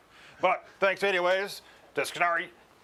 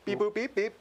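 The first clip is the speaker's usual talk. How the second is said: silly robot beeps; robot beeping noises; 6 semitones higher, faster than usual. Pretend. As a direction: beep boop like a little robot. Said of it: "make some funny noises".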